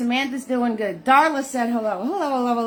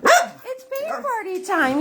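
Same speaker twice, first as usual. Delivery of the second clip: high dog-talk voice; 9 semitones higher, slower than usual. Real, not acted. Owner cooing to her excited dog Butters, high baby talk.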